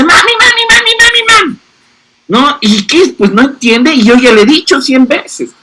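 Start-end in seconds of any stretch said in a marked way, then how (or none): 0.0-1.6 put-on high, insistent little-kid voice calling mami; a small child tugging for attention, pitched way up; child calling mom. Child pestering busy mom for attention, high insistent calls. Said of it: "y me dice".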